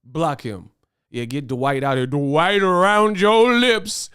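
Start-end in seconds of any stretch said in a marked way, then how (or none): 2.1-4.1 loud, high, stretched-out heckle; drawn-out taunting shout. Real, not acted. Streamer jeering at a chatter he is blocking, drawn-out shout. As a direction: holler it slowly, dragging out the words.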